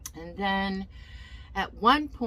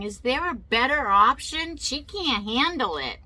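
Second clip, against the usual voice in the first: raised, higher-pitched imitation of someone yelling; retold yelling; 5 semitones higher, 4 dB louder than usual. Guest services lady objecting about the patient, mock yelling. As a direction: demand it loudly, retelling an outburst. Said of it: "she's yelling and saying".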